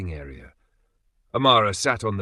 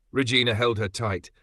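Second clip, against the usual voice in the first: ordinary delivery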